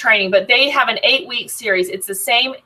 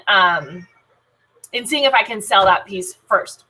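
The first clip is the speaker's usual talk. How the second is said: usual speaking voice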